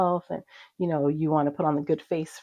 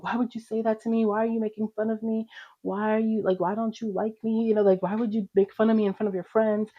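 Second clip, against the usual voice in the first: higher-pitched, hurt protest; retold upset outburst; 6 semitones higher than usual. Teenage daughter confronting mom for making fun, retold upset protest. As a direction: re-enact going off, without really yelling. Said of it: "and yelling, and I just kinda went off".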